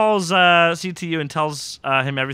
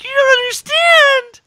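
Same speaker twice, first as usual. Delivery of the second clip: very high, loud, drawn-out wail; mock desperate woman; 10 dB louder, slower than usual. Host mimics accused woman protesting, mock desperate. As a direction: imitate her pleading in an exaggerated falsetto.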